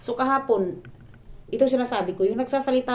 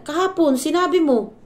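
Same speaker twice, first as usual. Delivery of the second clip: much higher and louder mocking older woman; mother-in-law's retort; 10 semitones higher, 9 dB louder than usual. Vlogger mimicking her mother-in-law's retort, high and loud. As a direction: snap back as the mother-in-law. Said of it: "sabi niya".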